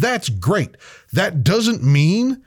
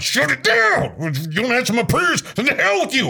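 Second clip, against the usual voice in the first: mock tough-guy defiance, pitched up; hammed-up manly refusal to cry; Conan; 5 semitones higher than usual. Man acting out Conan's man route, mock defiant. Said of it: "he went the man route".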